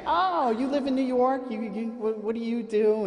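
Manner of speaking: high, eager chatty lady; mock chatty stranger. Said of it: "they wanna chat me up".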